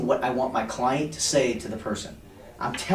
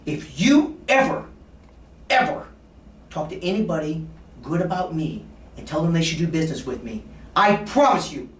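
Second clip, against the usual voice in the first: loud, high mock-stern warning; 4 semitones higher, 4 dB louder than usual. Shown as a demonstration. Salesman pretending to scold his client, mock stern. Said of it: "I'll be very stern in my voice".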